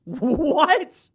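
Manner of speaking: high incredulous "what" through laughter; laughing disbelief. Real, not acted. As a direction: break up laughing as you ask. Laughing disbelief at conference name.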